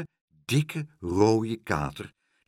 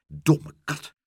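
higher-pitched exasperated cry; angry cry; 6 semitones higher, 4 dB louder than usual. A narrator's reading. Woman scolding the cat that failed her, angry cry. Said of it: "riep ze".

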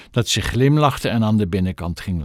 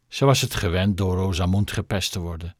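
ordinary delivery, nothing marked

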